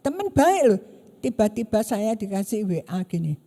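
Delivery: normal talking voice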